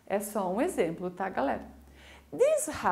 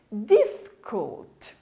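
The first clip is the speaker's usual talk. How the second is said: "Difficult" split slowly into two loud beats; slow, forceful teacher's breakdown of "Difficult"; syllable by syllable; 5 dB louder, much slower than usual. Shown as a demonstration. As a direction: sound out "Difficult" as diff-cult, with a gap. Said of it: "Tem duas sílabas".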